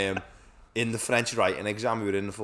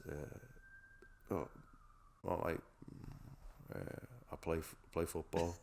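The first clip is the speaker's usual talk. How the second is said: hesitant mumble; 5 semitones lower, 15 dB quieter, slower than usual. Retold or imitated. Imitating own hesitant exam answer.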